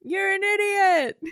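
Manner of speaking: high, drawn-out echo of a yell; stretched, high-pitched mock holler. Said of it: "when he's yelling into the void".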